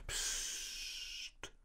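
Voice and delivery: whispered hush; warning hush. Acted. Oldest sheep hushes the restless lambs, whispered. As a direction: hush them sharply under your breath. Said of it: "mahnte das älteste Schaf".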